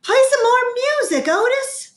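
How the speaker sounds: high, fast shout over the racket; shouting over noise. A narrator's reading. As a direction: call out over barking and yelling. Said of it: "And I shouted over".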